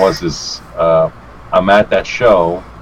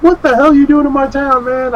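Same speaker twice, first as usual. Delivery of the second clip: very high-pitched, incredulous fan voice; hammed-up starstruck local; imitated amazed fan; 3 dB louder than usual. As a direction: exclaim it in put-on amazement.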